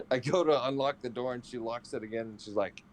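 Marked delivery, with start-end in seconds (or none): none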